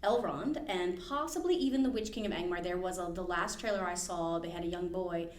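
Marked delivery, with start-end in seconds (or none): none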